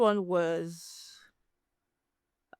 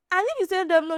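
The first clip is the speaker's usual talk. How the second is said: usual speaking voice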